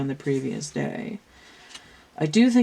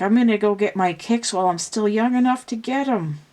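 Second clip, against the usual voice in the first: put-on raised, louder, brash teen rebel voice; sassy Rizzo quote; 6 semitones higher, 4 dB louder, faster than usual. Rizzo slipping out of the slumber party, brash and raised. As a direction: toss it off like a bad girl sneaking out to party. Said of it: "and she's like".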